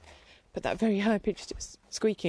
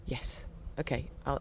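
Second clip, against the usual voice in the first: ordinary talk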